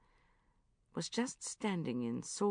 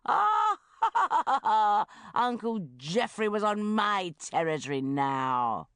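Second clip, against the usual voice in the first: much higher, loud triumphant laughing bellow; 4 semitones higher, 7 dB louder, slower than usual. A narrator's reading. Narrator gloating about Uncle Geoffrey, loud bellowing laugh. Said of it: "bellowing at the top of his voice".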